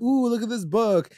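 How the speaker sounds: high-pitched, put-on intrigue; mock intrigue. Host voicing Heather showing Cheryl the book, high mock intrigue. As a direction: lift the voice high, imitating someone showing off a find.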